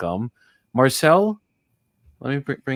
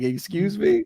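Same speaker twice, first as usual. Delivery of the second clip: surprised; 9 semitones higher, slower than usual. Retold or imitated. Jimmy's eyes get wide.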